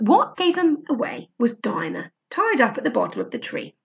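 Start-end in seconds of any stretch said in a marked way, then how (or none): none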